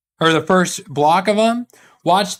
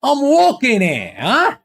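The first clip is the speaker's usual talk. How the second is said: high, drawn-out mock New York accent; put-on New York accent; 7 semitones higher, slower than usual. Trying to switch accents, in a hammed-up New York accent. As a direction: ham up a New Yorker's accent. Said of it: "I can't switch those accents".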